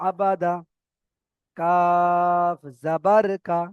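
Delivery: slow, stretched letter names with short final syllables; spelling demonstration. Shown as a demonstration. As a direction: recite each spelling slowly, holding the letter name. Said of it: "তো দেখেন".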